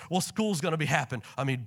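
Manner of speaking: ordinary delivery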